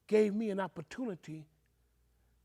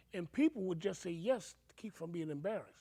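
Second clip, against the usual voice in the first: ordinary talk, nothing marked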